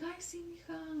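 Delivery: high, soft, absent-minded mom voice; nonchalant. Retold or imitated. Mom answering daughter while watching TV, nonchalant. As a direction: imitate a mother only half listening.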